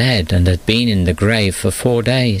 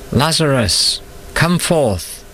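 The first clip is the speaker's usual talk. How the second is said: high, commanding call into the grave; 7 semitones higher, slower than usual. A narrator's reading. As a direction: summon the dead man, voice lifted high. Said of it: "Jesus called".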